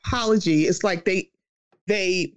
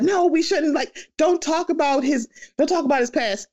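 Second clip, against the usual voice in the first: higher-pitched imitation of defensive fans; mock protest; 6 semitones higher than usual. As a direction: imitate people objecting on someone's behalf. Mocking fans who defend celebrities.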